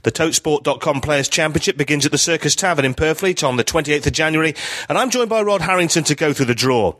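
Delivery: upbeat radio host opening read; podcast host intro. Acted. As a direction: open the show like a broadcaster. Podcast intro read over music.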